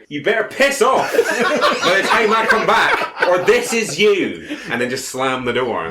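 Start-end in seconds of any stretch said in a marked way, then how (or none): 0.1-4.6 high, loud threat with a laugh in it; shout. Acted. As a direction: yell the threat through the door. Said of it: "throw them through the door and shout".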